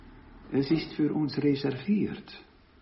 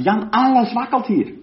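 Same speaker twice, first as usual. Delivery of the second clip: excited friend on phone; 8 semitones higher, 7 dB louder than usual. Retold or imitated. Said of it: "rief mich an und sagte".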